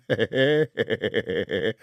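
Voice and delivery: gloating staccato giggle; nasty little gang laugh; mocking cackle. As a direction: snigger at your own joke. Gang laughs at its own taunt.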